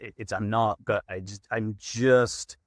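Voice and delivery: normal talking voice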